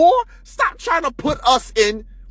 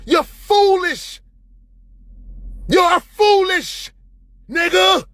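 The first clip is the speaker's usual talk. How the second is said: scornful shout; 7 semitones higher, much slower than usual. Real, not acted. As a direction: drag out foolish with contempt. Heated rant climax.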